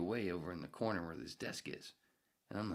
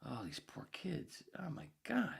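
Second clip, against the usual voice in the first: dismayed aside; 9 semitones higher, slower than usual. Retold or imitated. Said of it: "I'm just observing this going".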